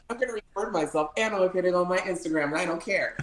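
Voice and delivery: high, fast, carefree bravado; giddy self-quote. Retold or imitated. Telling the host about the hot tub, giddy and high. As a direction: toss it off like you don't care who sees.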